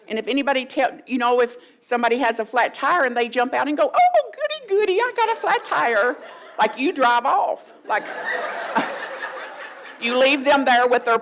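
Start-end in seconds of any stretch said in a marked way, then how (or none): 3.9-6.1 very high, loud gushing delight; hammed-up giddy excitement; mock glee. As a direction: squeal it in absurd over-the-top joy. Mocking joy at a flat tire.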